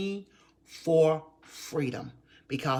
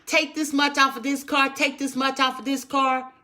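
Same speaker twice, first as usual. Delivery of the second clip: high, loud mock plea at the checkout; hammed-up shopper splitting a bill; pleading customer; 11 semitones higher, 7 dB louder than usual. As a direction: beg the cashier to split the payment. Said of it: "Or are you saying".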